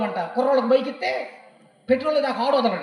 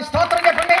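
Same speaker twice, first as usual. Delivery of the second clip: loud call; 7 semitones higher, 8 dB louder than usual. Real, not acted. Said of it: "చప్పట్లు కొట్టండి ఒకసారి".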